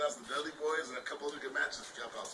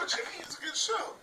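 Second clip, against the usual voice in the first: much higher, eager nervous reply. Retold or imitated. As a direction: squeak it out nervously to your hero. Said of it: "We're like".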